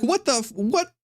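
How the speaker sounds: high, squeaky outraged sputter; mock-indignant stammer, pitched high; pissed archons. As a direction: sputter in disbelief, voice way up. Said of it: "So they're pissed".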